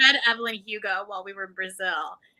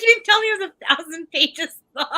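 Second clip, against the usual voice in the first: mock exasperated; 6 semitones higher, 5 dB louder than usual. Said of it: "I was like".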